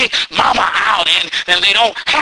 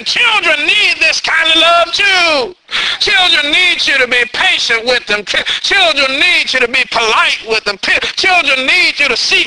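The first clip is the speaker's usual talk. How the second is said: raised fervent preaching; 6 semitones higher than usual. Acted. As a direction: lean in and preach it. Preacher pressing the point.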